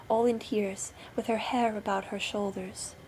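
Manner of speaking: usual speaking voice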